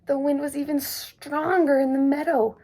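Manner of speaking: ordinary delivery, nothing marked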